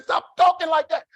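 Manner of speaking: imitated wife's high, loud outburst; exasperated shout. Wife silencing husband's crash talk, exasperated shout. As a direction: cut him off with a mock high yell. Wife snaps in acted quarrel.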